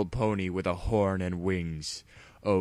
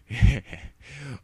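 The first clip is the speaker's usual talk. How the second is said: dark chuckle; 6 semitones higher, 6 dB louder than usual. Acted. Ex-partner revealing betrayal, dark chuckle. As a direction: laugh like a turncoat with a secret. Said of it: "chuckled darkly".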